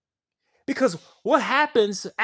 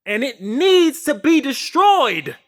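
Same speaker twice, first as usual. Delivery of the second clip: louder and slower, pressing each word; raised insistent declaration; emphatic; 5 semitones higher, 10 dB louder, slower than usual. Real, not acted. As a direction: drive the point home, building. Rising rant about destroying reality.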